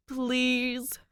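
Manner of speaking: high, loud pleading please; begging. Acted. Cop begging Danny, high and pleading. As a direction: beg her outright. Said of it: "he begs".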